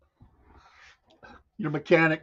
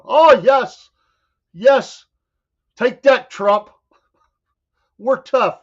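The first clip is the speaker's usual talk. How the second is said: mock gloating Canadian; 6 semitones higher, 7 dB louder than usual.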